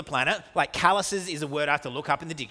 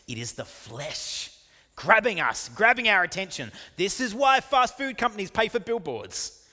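high, raised, impassioned pulpit voice; forceful preaching; 8 semitones higher, 4 dB louder than usual. Acted. Preacher declaiming Paul's agony to congregation, forceful preaching. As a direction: drive it home at full preaching pitch.

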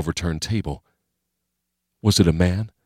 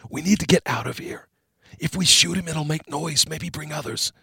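hushed urgent voice; 9 semitones higher, 5 dB quieter than usual. A narrator's reading. Hushed argument in hiding.

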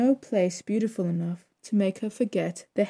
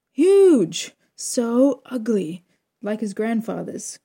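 exaggerated disgust; 3 semitones higher, 7 dB louder, much slower than usual. A narrator's reading. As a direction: blurt it out appalled. Mama recalling ugly baby.

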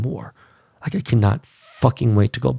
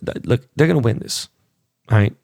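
usual speaking voice

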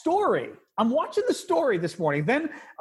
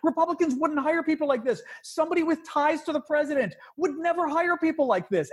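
high-pitched exasperated rant; real outrage, pitched up; incredulous indignation; 8 semitones higher than usual. Real, not acted. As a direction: press each point in high disbelief. Indignant rant about hiring propagandists.

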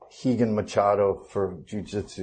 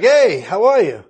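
friendly fan greeting; 7 semitones higher, 6 dB louder than usual. Retold or imitated. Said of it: "they're like".

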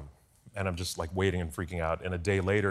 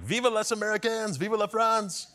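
high, ringing mock cheer; retold drunken shout; 4 dB louder than usual. Colleague shouting in the hotel at dawn, high mock cheer. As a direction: bellow it like a happy drunk at dawn. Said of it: "is just shouting at this point".

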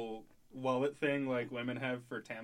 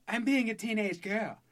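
higher teen girl impression with a different timbre; teenage girl voice; 7 semitones higher than usual. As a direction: speak like a sulky teenage girl. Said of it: "that's my teenage girl voice".

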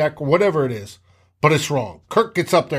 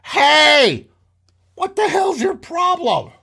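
angry shout; 12 semitones higher, slower than usual. Retold or imitated. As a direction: yell it like a fed-up receiver. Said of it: "waving his hands going".

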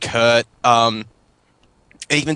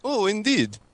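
fake Russian accent; 5 semitones higher, 5 dB quieter than usual. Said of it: "was a good Russian accent".

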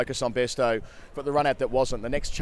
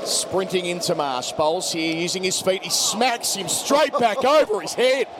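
cricket commentator; 5 semitones higher, slower than usual. Real, not acted. Live call of a six.

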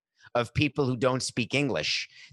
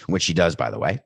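usual speaking voice

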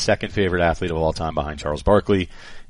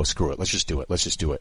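lower, softer conspiratorial push; hushed dare; 4 semitones lower, 6 dB quieter than usual. Retold or imitated. Host urging co-host at the interview's end, low and hushed. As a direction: nudge your partner, low and quiet. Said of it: "look at you and go".

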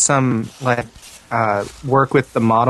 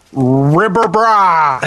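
slow mock callout; 5 semitones higher, 3 dB louder, much slower than usual. Shown as a demonstration. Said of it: "I think I did it wrong".

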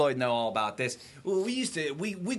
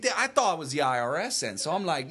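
ordinary delivery

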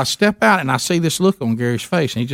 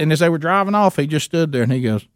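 normal talking voice

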